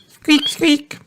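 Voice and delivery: very high little squeaks; rabbit squeak. A narrator's reading. Rebecca Rabbit piping up, squeaking. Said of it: "queers Rebecca Rabbit".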